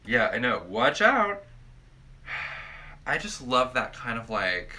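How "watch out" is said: playful evil warning, high and loud; menacing villain. Pretend. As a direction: shout it like a cartoon villain. Playing a being of pure evil.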